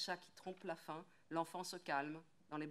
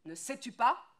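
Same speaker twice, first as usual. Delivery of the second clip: higher, louder repeat, snapping; angry shout; 7 semitones higher, 14 dB louder than usual. Acted. Woodcutter shouting at his wife over the baby. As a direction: hammer the question at her.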